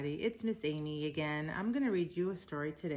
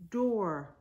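plain reading voice

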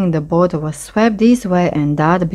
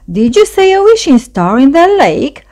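high, louder voiced storybook frog; croaking frog; 9 semitones higher, 5 dB louder than usual. A narrator's reading. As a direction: ask as an eager frog popping up. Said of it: "croaked Frog".

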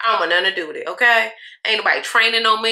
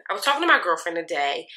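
ordinary talk

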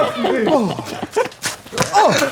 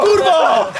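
loud, higher angry yell; frustrated shout; 8 semitones higher, 5 dB louder than usual. Real, not acted. Frustrated shout after bad throw.

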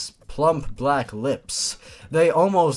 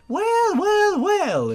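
sly villain voice; much slower than usual. Acted. Villain Jane confronts Jeff.

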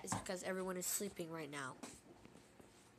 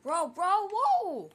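high, loud startled yell; excited shouting; 10 semitones higher, 14 dB louder, much slower than usual. Real, not acted. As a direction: shriek in shock. Friends freaking out at game.

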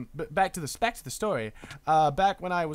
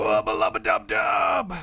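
lower, slow, shouted cartoon catchphrase; Rick catchphrase shout; 7 semitones lower, 6 dB louder, slower than usual. Answering chat, shouting a cartoon catchphrase. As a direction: belt it out like a cartoon scientist.

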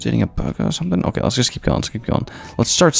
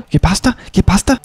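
mock Spanish; 9 semitones higher, 5 dB louder than usual. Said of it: "I don't know Spanish".

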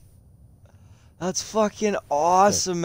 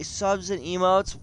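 ordinary talk

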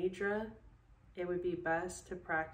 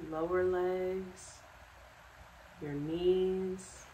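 calm, unhurried guided relaxation with long pauses; guided meditation; 3 dB quieter than usual. Acted. Yoga instructor guiding listeners to sleep in bed, calm meditation voice. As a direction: lead them through it step by step, leaving space after each instruction.

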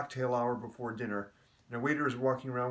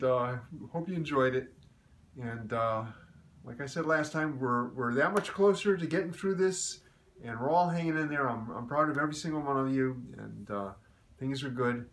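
ordinary talk, nothing marked

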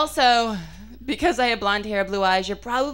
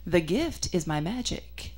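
slow, softer, deadpan "the gift is my magic"; completely serious; 6 semitones lower, 7 dB quieter, much slower than usual. Retold or imitated. Magician boyfriend answering her at a wedding, slow and deadpan. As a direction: say it softer and slower, dead earnest like a pompous magician. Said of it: "completely serious".

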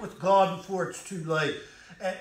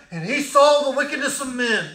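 thundered refrain; 9 semitones higher, 9 dB louder than usual. Acted.

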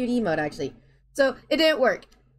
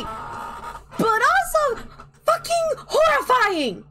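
high squeal; much slower than usual. Real, not acted. Squealing over being noticed.

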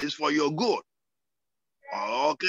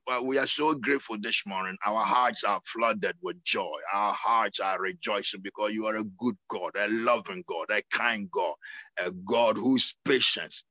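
prayer; 6 semitones lower than usual. Acted. Pastor leading a low thankful prayer. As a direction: pray in thanks, gently.

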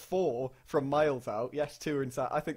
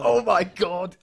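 a much louder gasp of real shock; stunned exclamation; 6 semitones higher, 12 dB louder, much slower than usual. Real, not acted. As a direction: blurt it out amazed. Stunned by a goal.

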